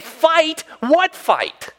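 high-pitched, punched-out disbelief; mock incredulity. Imagined David ends comeback to brother, incredulous. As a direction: throw it back high and incredulous.

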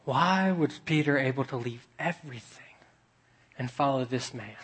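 hushed whisper; whispered question. Real, not acted. Preacher whispering the question to the church. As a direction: lean in and whisper it.

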